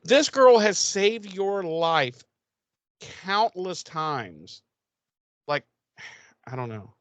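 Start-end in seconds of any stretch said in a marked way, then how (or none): 0.0-4.6 exasperated outburst. Retold or imitated. Podcaster fuming at Cordelia's insult, slow high outburst. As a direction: drag out the outrage, pitched way up. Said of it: "And I was like".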